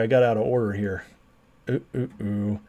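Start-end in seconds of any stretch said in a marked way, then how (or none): none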